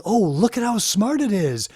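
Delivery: mocking, rising up high on the last line; mock boast. Mocking programmers bragging to the public about their AI. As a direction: show off like a proud programmer. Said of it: "Then they can say".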